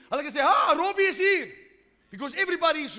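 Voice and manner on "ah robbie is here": bright, loud, high greeting; joyful welcome. Pretend. Someone in heaven warmly welcoming Robbie, excited. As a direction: greet him like an old friend arriving.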